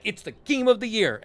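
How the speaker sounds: loud, high imitation of gushing fans; mock hype. Imitating people hyping a game, loudly excited. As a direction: proclaim it like an overexcited crowd, mocking.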